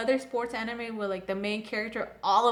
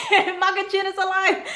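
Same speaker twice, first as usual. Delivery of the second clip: laughing; 7 semitones higher, 7 dB louder than usual. Real, not acted. Laughing repeat of the news.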